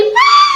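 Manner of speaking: a long high wailing scream, mimicking the ghostly shriek; imitated scream. Girl retelling a ghost story recreates the night shriek, imitated high scream. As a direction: recreate the eerie shriek she heard at night. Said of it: "चीखने वाली".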